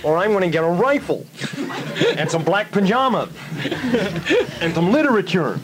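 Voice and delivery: high, comically outraged threat; hammed-up indignant tirade, pitched high; mock angry rant. Resident threatens the plane owners over his house, mock angry rant.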